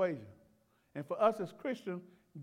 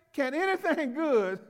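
exclaimed; 4 semitones higher, 4 dB louder than usual. Retold or imitated. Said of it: "He exclaimed".

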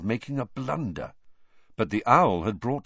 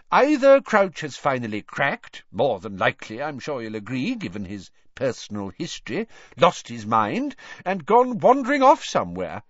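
1 Fudge pompous; 5 semitones higher, 4 dB louder than usual. A narrator's reading. Fudge character voice.

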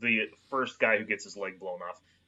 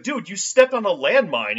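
loud, higher urgent shout; urgent call; 8 semitones higher, 9 dB louder than usual. Retold or imitated. Comrade shouting to the wounded soldier, loud and urgent. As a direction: yell the news at a wounded buddy.

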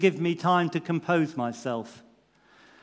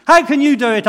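loud angry outburst, pitched much higher; angry shout; 10 semitones higher, 13 dB louder, faster than usual. A narrator's reading. Defendant shouts furiously at the judge in the dock. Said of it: "I shouted".

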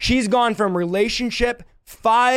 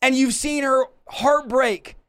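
higher, driving sermon voice; impassioned preaching; 4 semitones higher than usual. Acted. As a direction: preach it with rising fire. Preaching at full force.

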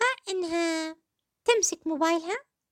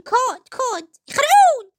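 slow, much louder and higher "كود، يخرعون"; mock scary quote; 6 semitones higher, 9 dB louder, much slower than usual. Host quoting the actress to viewers, exaggerated loud mock alarm. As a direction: echo her words as an exaggerated alarm. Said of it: "من الأشياء اللي قالتها".

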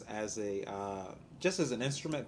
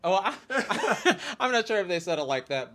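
loud, high laughing talk; 7 semitones higher, 9 dB louder than usual. Real, not acted. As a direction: talk through a big laugh. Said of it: "laughing through the line".

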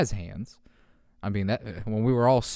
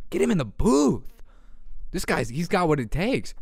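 excited hype; 5 semitones higher than usual. Real, not acted. Raving to viewers about the cat, excited.

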